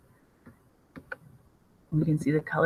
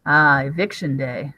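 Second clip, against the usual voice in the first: ordinary talk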